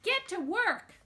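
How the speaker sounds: high, loud bossy shout; loud scolding shout; yelling. Acted. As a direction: order them back to work at full voice. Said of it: "I yelled at them".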